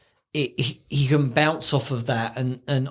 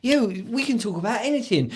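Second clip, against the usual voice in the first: lightly raised [name] laughing voice; a hint of giggly [name]; [name] encouraging Elmo, a bit higher; 8 semitones higher than usual. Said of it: "he's going".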